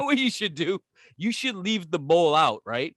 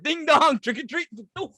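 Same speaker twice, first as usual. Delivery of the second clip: hammed-up excitement; 9 semitones higher than usual. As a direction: gleefully hand out canned goods. Repeat doorbell act.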